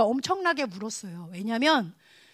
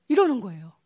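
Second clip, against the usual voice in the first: ordinary delivery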